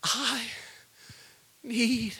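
halting, tender prayer to God; soft prayer. Acted. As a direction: speak each word alone to God. Prayer, soft then swell.

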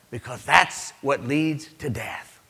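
usual speaking voice

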